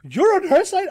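high, loud disbelieving question; incredulous. Retold or imitated. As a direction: repeat it back, outraged. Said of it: "I said to her".

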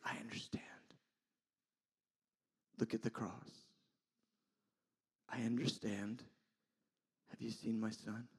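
hushed, softened voice with long pauses; gently reassure, dropping into whisper at times; tender understanding. Acted. Compassionate God showing a sinner the cross, tender and hushed.

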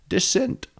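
put-on high-pitched silly Dutch accent; mock Dutch. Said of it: "or in Dutch".

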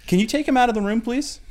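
very high, loud, strained request; exasperated mock plea. Parent asking partner to remove the kid, exasperated. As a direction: plead as a parent at wit's end.

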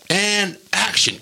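drawn-out, high-pitched action call; mock yell to start the take; imitated director's yell. Director starting the take, mock yelling. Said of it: "you hear Adam yell".

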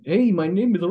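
aborted impression of a poor American accent; attempted bad American accent. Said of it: "he forgets that he's playing an American".

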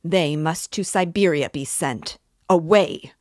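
Amalia yelling. Acted. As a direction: scream for the police.

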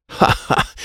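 short "ha ha" laugh. A narrator's reading. Boy laughs as friend insists they are trapped, laughing. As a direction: laugh out a mocking-free ha ha. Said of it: "Wyatt laughed".

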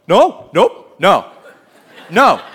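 loud, laughing no; laughing refusal. Retold or imitated. Peter rejecting the idea, loud and laughing. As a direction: shout no through laughter.

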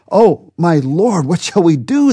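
high, slow cry of alarm from the servant; frightened servant. Acted. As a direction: plead in panic, voice lifted. Frightened servant sees the army.

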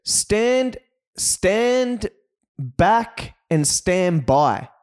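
high then slowing, mock Trump; mock Trump, corrected. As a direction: drag out "stand by" slowly.

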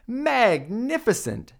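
high, slow magnificent; flattering awe. Acted. Princess flatters the dragon, slow and high. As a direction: lay the praise on thick. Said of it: "said Elizabeth".

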